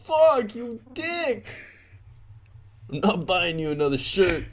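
angry dad yelling. Pretend. Voicing the angry dad in game.

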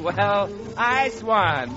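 normal talking voice

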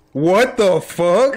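slow drawn-out stunned what the fuck; stunned, dragging the words out; shocked disbelief. Real, not acted. Shocked at losing the round.

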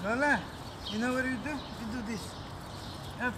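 high, soft coaxing voice. Real, not acted. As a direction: coax the dog sweetly. Coaxing dog.

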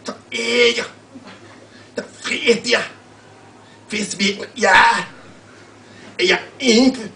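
put-on high, loud made-up Danish accent; imaginary Danish accent. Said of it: "I'm going to read it with an imaginary Danish accent".

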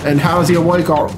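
usual speaking voice

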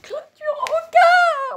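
squeaky excited cry; high squeal. Real, not acted. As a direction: squeal with delight. Squeal at ferret.

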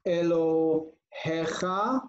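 Elohecha slowly in syllables, e-lo... he-kha; careful pronunciation. Shown as a demonstration. Teacher showing "your God" with its ending, Elohecha said slowly and distinctly. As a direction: break up Elohecha, pausing before he-kha. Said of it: "you add the endings".

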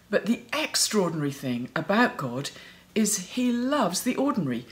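ordinary delivery

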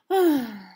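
a long, audible sigh out loud; heavy sigh. Acted. Circle sighs before going to find Triangle: heavy sigh. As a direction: let out a big resigned sigh. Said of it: "Circle sighed".

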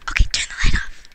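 whispered instruction. Real, not acted. Putting the Sims son to bed, whispered. Whispers during bedtime scene.

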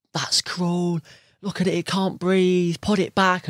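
high, slow scolding outrage; indignant Karen. Retold or imitated. Karen confronting an angler over the fish, high, slow scolding. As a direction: scold the angler like a self-righteous busybody. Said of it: "where they're like".

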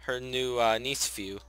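usual speaking voice

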